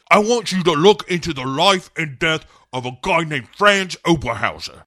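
high, loud mock hero voice; Bond. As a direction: issue the order with hammed-up urgency. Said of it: "He says".

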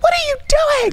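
high, loud frantic cry of "what are you doing"; frantic yell. Retold or imitated. Imitating herself yelling frantically at friends over old photos. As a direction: shriek it in a panic, imitating herself. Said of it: "like, frantic".

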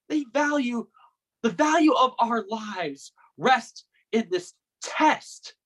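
venting, building on repeated numbers; bitter rant. Acted. Brother rants about students being just numbers, bitter and stammering.

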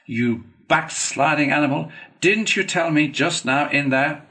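loud, high indignant reproach; shocked, scolding Badger; scandalized. A narrator's reading. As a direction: accuse him in outraged disbelief. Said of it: "greatly scandalized".

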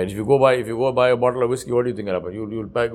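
ordinary delivery

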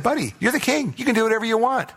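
high-pitched wifely egging-on; lightly voiced Jezebel. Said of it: "Jeze came along and said".

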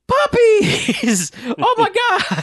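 high, loud thrilled laughing shout; excited. Retold or imitated. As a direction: squeal with delight at the puppies. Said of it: "it's just like".

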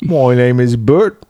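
sing-song mock cockney, Mary Poppins style; hammed-up Bert cockney.

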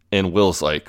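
normal talking voice

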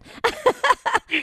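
a sudden high giggle at herself; high burst of laughter. Real, not acted. Laughing at her own tongue-twister with the host.

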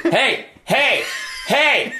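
high, insistent mock calls for attention; imitated drunk. Drunk friend bursting into the garage, high pestering calls. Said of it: "comes in there all drunk and goes".

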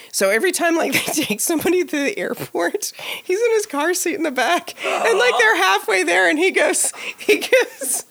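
high breathless giggling through the words; can barely get it out for laughing. Real, not acted. Host laughing while telling the creepy story.

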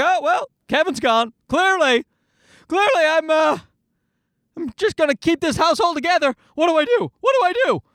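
high-pitched, frantic dog voice; comic nervous wreck, voice pushed way up; panicking dog. Acted. Anxious dog left in charge when the owner's gone, panicking. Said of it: "he was a wreck. Because he's like".

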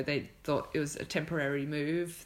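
normal talking voice

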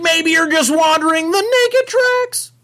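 very high, loud cartoon slacker voice; hammed-up high cartoon sidekick; Shaggy. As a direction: hatch a plan in an excited cartoon squeal. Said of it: "I have to hear the Shaggy".

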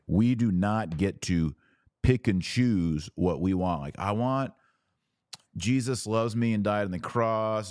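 ordinary talk

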